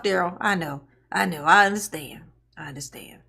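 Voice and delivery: ordinary talk, nothing marked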